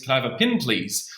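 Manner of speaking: put-on light Kiwi accent, pen said as "pin"; mock New Zealand accent. Australian lightly mimicking a Kiwi asking for a pen. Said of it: "and then they say".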